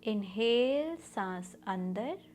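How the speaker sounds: slow, measured meditation voice with long pauses; guided meditation. Acted. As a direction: guide them through each breath, drawing the words out. Leads a guided breathing meditation.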